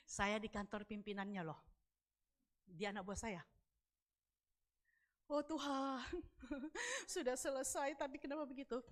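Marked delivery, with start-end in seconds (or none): 5.3-8.8 half-crying complaint to God; retold dismay with a whimper; mock-tearful lament. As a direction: whine it as a wounded plea. Retold wail to God after rebuff.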